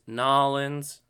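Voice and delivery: Nolans, two syllables, "NAW-lins"; local slurred pronunciation. Shown as a demonstration. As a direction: squash New Orleans down to a loud "Nolans". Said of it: "depends how you say it".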